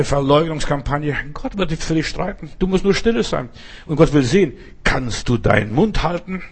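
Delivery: usual speaking voice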